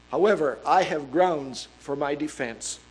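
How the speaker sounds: ordinary talk, nothing marked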